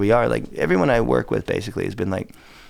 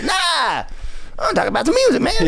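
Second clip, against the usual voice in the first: [name] impression. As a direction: end on his catchphrase with swagger. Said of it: "[name]".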